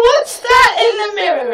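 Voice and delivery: high, loud horrified question; startled cry at the mirror; shocked. Acted. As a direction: recoil at what you see. Shock at own reflection.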